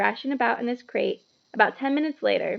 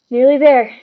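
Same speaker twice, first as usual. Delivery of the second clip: panting; 3 semitones higher, 15 dB louder than usual. A narrator's reading. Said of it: "Harry panted".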